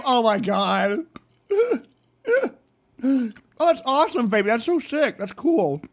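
ordinary talk, nothing marked

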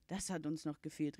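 ordinary delivery, nothing marked

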